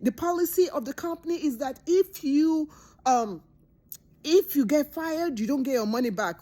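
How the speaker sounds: high, fast, snippy boss voice; high mocking boss voice; mean boss. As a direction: play the petty boss refusing her pay. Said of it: "her boss is like".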